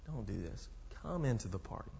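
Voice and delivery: soft, pleading plea to a son; pleading father. Retold or imitated. Father softly begging his angry son to come inside. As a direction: gently beg him to come in. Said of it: "he's begging his son".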